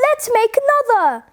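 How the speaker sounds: put-on high, eager kids' shout; children calling out to each other, high. A narrator's reading. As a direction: cry out like a child keen to play on. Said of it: "shouted the children".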